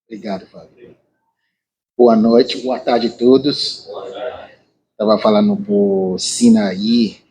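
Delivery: Portuguese with a Nigerian accent; english accent portuguese. Real, not acted. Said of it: "Desculpa meu português".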